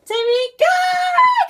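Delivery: very high, loud, drawn-out call of a name; mom calling my name. Retold or imitated. Daughter imitating mom calling her name, long and loud. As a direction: belt the name out like a mom calling across the house. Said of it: "that's how she says my name".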